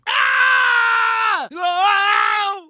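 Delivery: high shouted ow; pained yelps. Acted. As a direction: yelp as you get slammed. Crash and getting hit.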